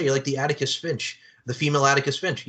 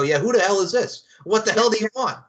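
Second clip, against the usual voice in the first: louder, higher brusque phone greeting; mock rude receptionist; 7 semitones higher, 5 dB louder than usual. Receptionist made to greet callers rudely, mock-aggressive. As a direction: bark it like a hammed-up rude receptionist. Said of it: "she's making her go".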